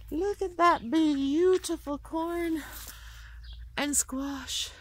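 high, slow, delighted; proud delight. Real, not acted. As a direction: show it off with pride. Proudly showing her garden.

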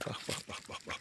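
breathy whispered bak bak bak; hushed whisper. Real, not acted. Pointing out her dog to viewers, whispered. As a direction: whisper so you don't disturb the dogs.

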